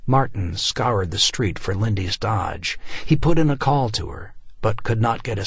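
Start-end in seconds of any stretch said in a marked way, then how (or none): none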